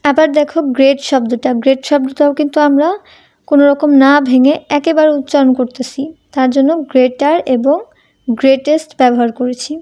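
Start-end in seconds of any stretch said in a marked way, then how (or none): none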